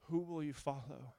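ordinary delivery

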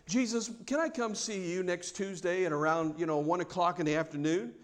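low, soft polite asking; meek, softly spoken request; mock polite request. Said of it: "she does not come politely".

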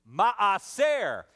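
"Maaser" said mah-SEHR with a long first a; Hebrew word. Shown as a demonstration. Pastor teaching the Hebrew word "Maaser", said mah-SEHR. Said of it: "You say that with me?".

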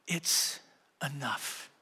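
weary quiet. Retold or imitated. Jesus telling the disciples he is done, weary and hushed. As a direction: let "It's enough." sink, drained and quiet. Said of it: "he said this statement".

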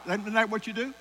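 ordinary delivery

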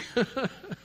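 a last little chuckle; short laugh. Real, not acted. Closing laugh.

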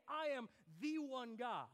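"the" punched and set apart before "one guy"; weighty "the", claiming to be the only one; stress on THE. Shown as a demonstration. Preacher voicing what John is not saying, emphatic THE. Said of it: "He's not saying".

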